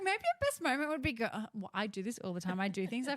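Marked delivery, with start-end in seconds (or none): 0.0-1.3 bright retold idea. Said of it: "I was like".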